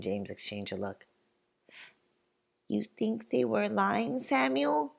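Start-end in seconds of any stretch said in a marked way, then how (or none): 2.7-4.9 higher, hopeful small question; ask, wanting it to be a lie; hopeful boy's higher plea. Acted. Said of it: "James asks me hopefully".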